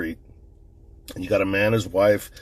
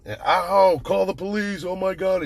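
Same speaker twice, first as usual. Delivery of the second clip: higher, panicked retold cry of the shot husband; mock panicked cry; 11 semitones higher than usual. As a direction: cry out in pain and panic, imitating the victim.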